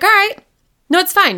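brightly insisting it's fine, high and loud; forced cheer. Retold or imitated. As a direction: chirp it like you're hiding disappointment. Forced cheer after no proposal.

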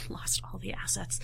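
whispered aside; confiding whisper. Real, not acted. Ex-employee confiding Disney's lost files, whispered. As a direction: drop to a hushed, unvoiced confession. Confiding an embarrassing company mishap.

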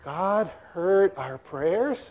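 slow, soft, wondering question; hushed awe. Acted. Elizabeth learns their prayers were answered, hushed and awed. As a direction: breathe it slowly in wonder, rising at the end.

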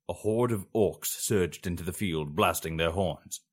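usual speaking voice

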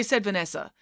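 ordinary delivery, nothing marked

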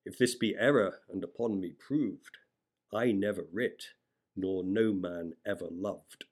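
ordinary delivery

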